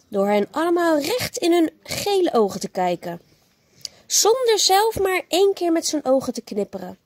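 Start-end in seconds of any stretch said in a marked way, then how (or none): none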